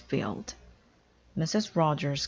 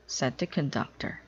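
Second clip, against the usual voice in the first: usual speaking voice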